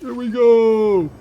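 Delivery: high, loud, drawn-out cheer; excited cheer. Real, not acted. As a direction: celebrate it slowly at full voice. Cheering after a long silence.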